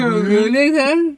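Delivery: cerulean drawn out very slow and strange; bear pronouncing cerulean. Shown as a demonstration. Asked how the bear says it, a slow mangled cerulean. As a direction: stretch "cerulean" out slowly like a bear's tongue exercise. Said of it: "What does that sound like?".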